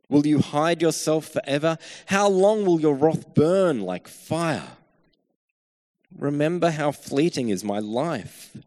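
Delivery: loud, urgent reading of a lament psalm; impassioned scripture reading. Acted. Preacher reading the psalm aloud to his congregation, impassioned. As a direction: proclaim the psalm's complaint with rising force. Reads psalm with mounting force.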